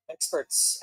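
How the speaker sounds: low muttered jab; muttered sarcasm. Acted. To the viewers, muttered scorn at experts. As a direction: drop it under the breath.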